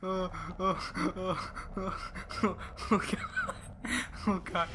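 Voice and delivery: high, breathless shaken repeats; recovering from a fright. Real, not acted. After jump scare.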